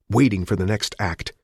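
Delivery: ordinary delivery, nothing marked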